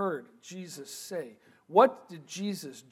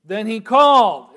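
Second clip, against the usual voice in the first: called out loud to a crowd; 4 semitones higher, 13 dB louder than usual. Acted. Preacher voicing Jesus calling the crowd, loud. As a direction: call it out as to a crowd.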